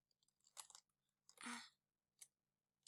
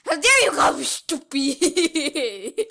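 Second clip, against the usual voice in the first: mock outrage; 3 semitones higher, 10 dB louder, slower than usual. Mock argument, loud and slow.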